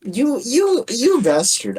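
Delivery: high, sputtering pretend fury; mock outrage. Friend teased for sounding American, mock outrage.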